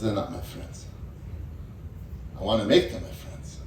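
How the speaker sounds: deep, low king's reply, partly breathed; a grave old king explaining himself, low; father king answering his son in a deep low voice. Acted.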